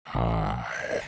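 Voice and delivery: very low, slow, sultry creep; mock-seductive predator purr; predatory sensual. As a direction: drop it deep and soft, overdoing the seduction. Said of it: "those were predatory".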